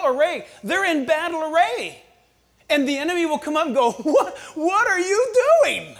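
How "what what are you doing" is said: high, slow, laughing scoff; mocking disbelief. The enemy scoffs at a believer caught praying, laughing mockery. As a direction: sneer at someone who's praying.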